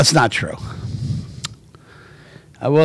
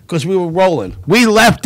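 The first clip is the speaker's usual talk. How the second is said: ordinary talk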